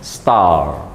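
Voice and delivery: low star with drawn-out A; long A in star. Shown as a demonstration. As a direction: hold the A in star. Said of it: "bacaannya agak panjang sedikit".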